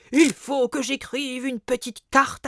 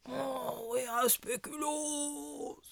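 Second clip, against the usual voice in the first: softer sleepy mumble, trailing off; sleep talking, fading; 13 dB quieter than usual. Acted. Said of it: "il parle en dormant".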